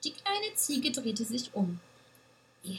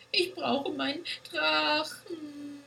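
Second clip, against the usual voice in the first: put-on child's bellow; 6 semitones higher, slower than usual. A narrator's reading. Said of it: "brüllte sie aus vollem Hals".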